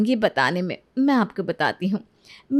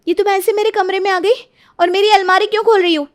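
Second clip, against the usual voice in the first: higher, louder, faster confrontation; angry challenge; 8 semitones higher, 6 dB louder, faster than usual. Acted. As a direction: demand answers sharply. Wife catches rival at her cupboard. (गुस्से में सवाल करती पत्नी)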